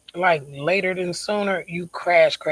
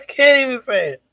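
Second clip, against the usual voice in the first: real laugh breaking through the words, higher and louder; 7 semitones higher, 6 dB louder than usual. Real, not acted. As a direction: laugh it out in disbelief. Repeats the line while laughing.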